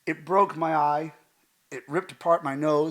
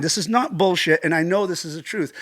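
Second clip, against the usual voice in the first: usual speaking voice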